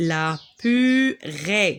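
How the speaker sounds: "la purée" split slowly as "pu-rée", stress on "rée"; slow model reading. Shown as a demonstration. Teacher modelling a word for pupils to repeat, slowly. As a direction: sound out "la purée" syllable by syllable. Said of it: "On répète pour mieux apprendre".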